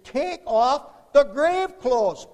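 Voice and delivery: very loud, high, drawn-out call; forceful command. Acted. Preacher gives Paul's message as grave-side command, forceful call. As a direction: proclaim it slowly at full force.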